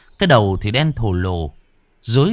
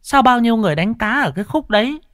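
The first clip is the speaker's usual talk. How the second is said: high, fast village mother; 11 semitones higher, faster than usual. Acted. Mother explaining aloud to the family. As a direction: chatter like an old mother.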